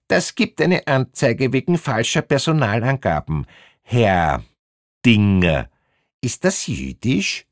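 lightly voiced high-pitched official; a hint of needling bureaucrat; officious female inspector. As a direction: threaten formally, then probe the name. Inspector threatens a report, probes name.

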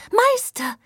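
tiny doll voice. Acted. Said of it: "rief die entzückende Puppenharfe".

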